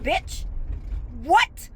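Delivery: ordinary talk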